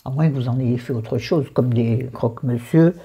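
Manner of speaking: ordinary talk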